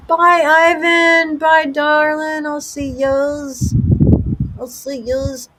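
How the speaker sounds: high, loud, drawn-out put-on voice calling bye darling; mock sweet farewell. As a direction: sing out a mock-affectionate goodbye, slowing down. Playful goodbye in a put-on voice.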